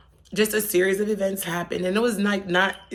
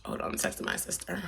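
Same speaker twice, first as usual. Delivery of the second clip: whispered aside; 11 dB quieter, faster than usual. Real, not acted. Aside while texting.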